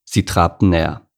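usual speaking voice

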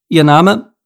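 loud, high demand for a name; curt official question. A narrator's reading. Officer questioning Mike without looking up, loud and official. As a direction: demand it loudly, pitch lifted. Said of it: "Ohne aufzusehen, fragte".

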